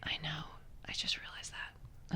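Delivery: hushed embarrassed aside; sheepish whisper. Real, not acted. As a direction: confess it under your breath.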